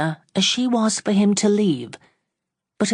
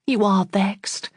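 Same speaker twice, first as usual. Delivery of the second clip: sadly; 3 semitones higher, slower than usual. A narrator's reading. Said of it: "said she sadly".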